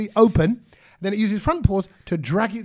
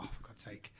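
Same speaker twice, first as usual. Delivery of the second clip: whispered under the breath, fed up; a fed-up whisper to himself; exasperated whisper; 24 dB quieter than usual. Retold or imitated. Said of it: "and I thought".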